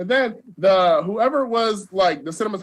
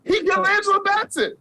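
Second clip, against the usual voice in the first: normal talking voice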